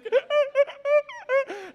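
high, loud gasping sob; choking sob imitation. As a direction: imitate a kid's choking cry. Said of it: "can't breathe cry".

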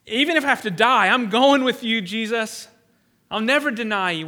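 loud, confident boast of loyalty; bold vow. Retold or imitated. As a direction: swear loyalty loudly and proudly. Voicing Peter's bold promise.

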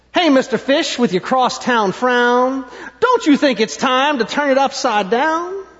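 cheery clam. Acted. Clam advises the glum fish.

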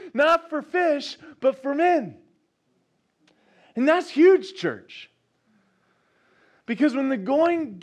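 high, loud, impassioned preaching; preaching at full force. Real, not acted. Preacher to congregation, fervent high preaching. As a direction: drive it home to the church.

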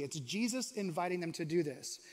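normal talking voice

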